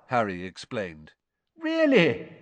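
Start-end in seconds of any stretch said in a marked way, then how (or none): 1.6-2.3 very high, delighted question; thrilled, pitched right up; excited. A narrator's reading. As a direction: pipe up in eager fascination. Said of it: "said Mister Weasley's voice excitedly".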